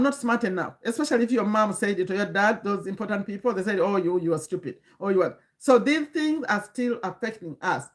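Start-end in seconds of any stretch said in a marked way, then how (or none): none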